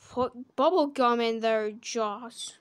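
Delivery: ordinary delivery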